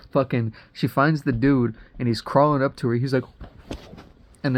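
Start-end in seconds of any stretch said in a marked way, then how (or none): none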